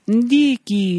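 high, drawn-out mock name; high teasing singsong on Dickie; sing-song Dickie. Mocking the name in unison.

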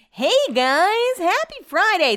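bright vlog greeting. Real, not acted.

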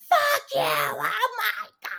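very high, squealed retort breaking into laughter; hammed-up high-pitched indignation; mock outrage. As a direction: imitate a rattled prank victim exploding. Imitates friend furious after prank reveal.

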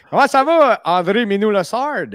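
high, loud showman welcome; excited greeting. Acted. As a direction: greet him like a game show host. Greeting guest with mock fanfare.

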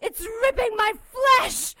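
high shrieking agony; agonized screaming. Acted. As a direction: scream as holy wood burns. Demon whipped with holy lash.

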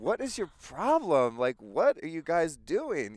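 imitating her bemused and slow; amused disbelief. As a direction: stretch out her bemused question. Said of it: "You're, like, laughing".

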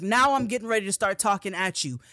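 building to a high, loud furious yell; angry demand. Retold or imitated. Passenger fed up with the driver, building to a loud yell. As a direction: lose it and order him. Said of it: "enough of you motherfucking screaming at me".

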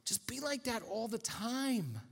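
gentle. Retold or imitated. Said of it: "And Jesus says".

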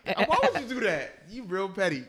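high offended complaint; outraged at the prank; indignant. Real, not acted. Phone shoved in face.

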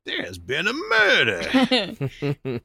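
theatrical imitation Southern accent. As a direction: stretch it out in hammed-up Southern. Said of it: "That episode is my absolute favorite".

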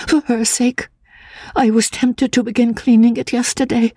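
ache over the stained tomb; timid devoted young woman. Acted. Anne to the narrator at her benefactress's grave, high and pleading.

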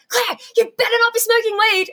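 high, loud imitated scolding; mock-outraged shout from below; retold screaming. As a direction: mimic a producer yelling up at you. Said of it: "screaming at me".

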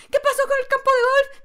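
retold panic at a missing golf course; panicked disbelief. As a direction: ask like the ground vanished. Panicked at lost landing spot.

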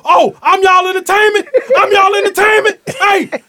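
high, loud sarcastic yell; sarcastic outburst. Retold or imitated. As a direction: throw it at the staring crowd. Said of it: "and so I go".

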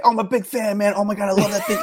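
high, laughing fake enthusiasm; mock fan gush. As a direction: gush like a starstruck fan while half laughing. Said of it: "I say".

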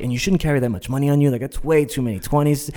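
fast, high, flustered rambling; imitating a nervous motormouth; nervous babbling. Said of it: "I was babbling".

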